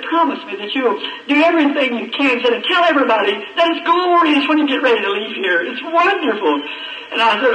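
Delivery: high, loud impassioned preaching; fervent preaching. Acted. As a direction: preach it out with rising fervour, voice lifted high. Preaching climax at full force.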